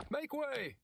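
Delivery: pushing through the crowd; shout. A narrator's reading. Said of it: "Pete shouted".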